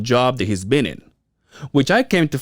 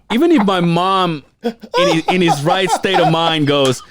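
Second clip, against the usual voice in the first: laughing exasperation; 7 semitones higher than usual. Retold or imitated. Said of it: "I'm like".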